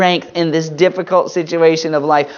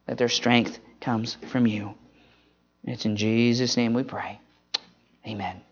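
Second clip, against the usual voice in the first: prayer; 3 semitones lower than usual. Real, not acted. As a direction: pray it gently, fading to Amen. Pastor prays for moms.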